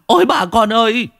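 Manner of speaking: high-pitched terrified wail; panicked screaming. A narrator's reading. Young villager thinks the dead man is now a zombie, screaming in panic. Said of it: "ôm đầu gào thét".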